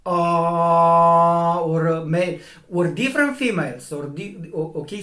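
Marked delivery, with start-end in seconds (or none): 0.1-1.6 loud, long, level open vowel; demonstrated "ah" tone, swelling louder; sustained vowel tone. Shown as a demonstration. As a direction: hold the vowel out steadily and firmly. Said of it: "It is an atone".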